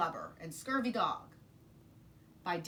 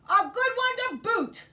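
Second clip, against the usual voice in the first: coro de piratas, muito mais alto e agudo; tripulação concordando aos gritos; 13 dB louder than usual. Acted. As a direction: grite junto como uma tripulação inteira. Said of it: "they all agreed".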